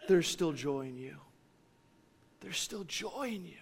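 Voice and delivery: very soft, intimate recollection; quiet, reverent near-whisper; hushed prayer. Retold or imitated. Recalls praying on a hospital bed.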